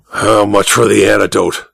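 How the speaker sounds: low growled demand. Acted. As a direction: growl it out menacingly. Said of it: "Sangstrom growled".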